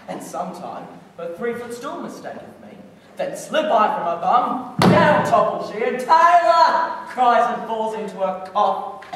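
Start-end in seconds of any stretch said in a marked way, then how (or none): 3.2-9.0 excited shouted storytelling, leaping up in pitch; loud comic climax. Acted. Puck relives the aunt toppling off her stool, loud and gleeful. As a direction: build to a high mock cry on the topple. Climax of Puck's prank tale.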